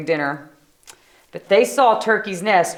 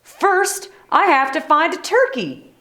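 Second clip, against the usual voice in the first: higher bellowed reply; bellowing back; 8 semitones higher than usual. Acted. Moose bellowing up the hillside to Sheep. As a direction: call it back up the hill. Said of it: "Mister Moose bellowed back".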